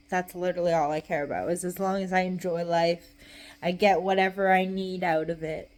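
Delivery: ordinary talk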